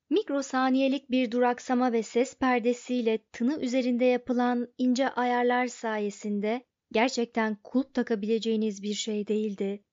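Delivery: usual speaking voice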